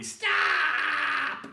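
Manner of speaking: high, full-force shout; child's piercing holler for help; very loud yell. Acted. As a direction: yell it as one long cry. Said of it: "Taya yelled very loudly".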